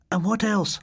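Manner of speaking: put-on girl voice. Mavis voiced high.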